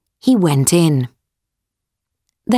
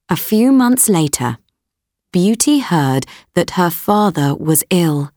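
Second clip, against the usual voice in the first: ordinary delivery, nothing marked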